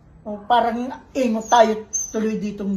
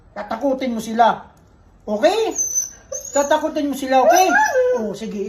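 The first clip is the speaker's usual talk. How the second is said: normal talking voice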